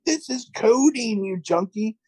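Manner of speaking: high, drawn-out retold outburst; mock outraged accusation. Host as man confronting date over bottle, mock outrage. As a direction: imitate a hysterical accuser, slow and high. Acted quarrel over cough syrup.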